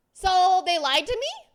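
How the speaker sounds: loud, drawn-out indignant question; mock outrage. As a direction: act out slow, high, loud disbelief. Retold outrage at being lied to.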